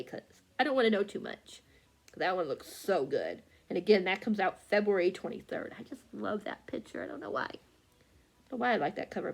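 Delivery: normal talking voice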